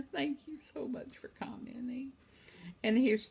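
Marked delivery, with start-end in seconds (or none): 0.1-2.1 breathy whisper of thanks. Real, not acted. Grandma thanking a commenter in a whisper. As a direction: whisper a grateful thank you.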